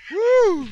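high loud excited woo; high whoop. Real, not acted.

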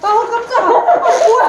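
startled. Real, not acted. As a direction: jump at a sudden fright. Sudden startle.

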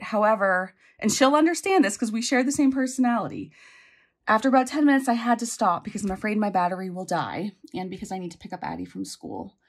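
ordinary delivery

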